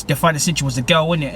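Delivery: ordinary talk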